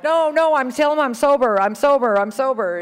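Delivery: high, loud, insistent protest; urgent reenacted plea, repeated in a rush of protest; frantic retold pleading. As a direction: act out desperately begging to be believed. Pleads from jail as dad refuses.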